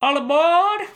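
high loud conductor shout; train conductor call. Acted. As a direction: belt it like a conductor.

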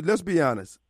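ordinary talk

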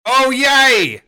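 loud, high mangled Aussie cry; bad Australian accent. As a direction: shout it in a badly faked Australian voice. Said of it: "wasn't gonna do a good Australian accent".

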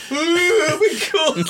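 high, startled yelp of being caught; mock caught surprise. Friend mimicking the caught man for the podcast, high startled squeak. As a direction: play the guy caught mid-act, squeaking in alarm. Imitating caught pooer's reaction.

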